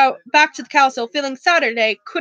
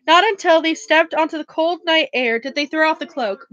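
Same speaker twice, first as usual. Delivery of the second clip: plain reading voice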